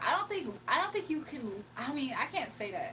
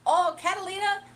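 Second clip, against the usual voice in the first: excited reaction; 8 semitones higher, 9 dB louder than usual. Real, not acted. Delighted reaction to suggested name.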